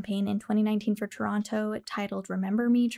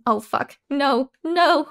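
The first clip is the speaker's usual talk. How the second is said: startled outburst; 4 semitones higher, 8 dB louder, much slower than usual. Real, not acted. Screen shows something wrong.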